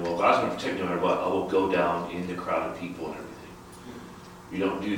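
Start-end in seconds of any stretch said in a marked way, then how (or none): 0.0-3.3 rattled off fast and carefree; careless believer, fast. Retold or imitated. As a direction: rush it like a careless boast. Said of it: "it doesn't mean then".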